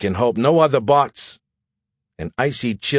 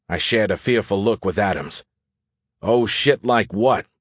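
ordinary delivery

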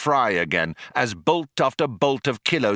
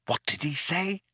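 soft, high, disbelieving whisper; astonished whisper; 8 semitones higher, 7 dB quieter than usual. A narrator's reading. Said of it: "whispered Ford in astonishment".

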